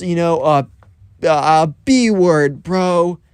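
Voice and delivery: mock-tough bully making a show in front of everyone; bully calling out.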